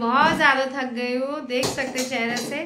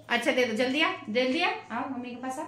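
usual speaking voice

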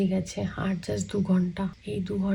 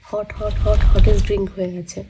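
suddenly much louder, exaggerated complaint; mock outrage; 3 semitones lower, 13 dB louder than usual. Real, not acted. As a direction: burst out about the hot drinks. Mock drama over warm drinks. (জোরে নাটুকে অভিযোগ)

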